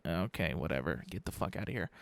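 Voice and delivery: low, quiet brush-off; imitated under-the-breath scorn; mock dismissal. Acting out a dismissive reaction he denies.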